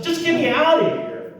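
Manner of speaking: very high-pitched, urgent begging; exasperated plea. Retold or imitated. Stuck driver begging a stranger for help, exasperated. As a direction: act out a desperate plea in a high voice.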